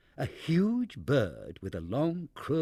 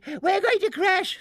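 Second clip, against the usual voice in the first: much higher, louder second scream; shrill scream; 6 dB louder than usual. A narrator's reading. Barnaby's mother at the height of panic, higher louder scream. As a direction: let the panic peak. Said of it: "screamed Barnaby's mother".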